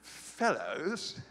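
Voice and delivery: lightly British, drawn-out questioning "fellows"; mock British. Preacher puzzling over the translation, mock British. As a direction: query it like a puzzled British chap. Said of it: "That sounds like a British chap".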